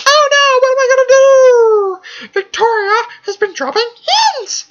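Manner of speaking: high, loud panicked squeal; mock panic. Mocking Ted's panic over the hints, a high loud shriek. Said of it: "Ted's like".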